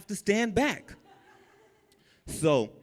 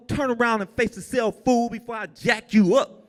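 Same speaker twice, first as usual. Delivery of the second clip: higher, bluffing hard; scared inmate faking a tough-guy threat; tough talk; 10 semitones higher, 4 dB louder than usual. Retold or imitated. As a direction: bark the order like a tough guy. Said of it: "talking all kind of junk".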